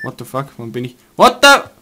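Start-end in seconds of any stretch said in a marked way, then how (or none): 1.2-1.7 surprised shout. Real, not acted. Sudden shock in game.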